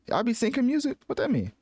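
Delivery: high, incredulous question; surprised. Real, not acted. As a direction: jump up in disbelief. Puzzled by a chart.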